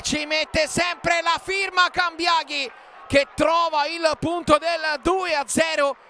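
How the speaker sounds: excited shouted goal call; goal commentary shout. Real, not acted. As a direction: call the header goal at full voice. Second goal called out.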